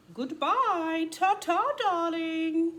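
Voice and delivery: high, loud, grand goodbye; posh aunt voice. Acted. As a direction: sing it out like a fancy aunt. Fancy aunt saying goodbye.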